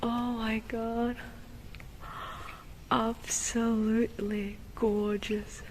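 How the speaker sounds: hushed, breathless whisper of wonder; awed whisper. Real, not acted. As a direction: whisper it in amazement. Awed at church interior, hushed.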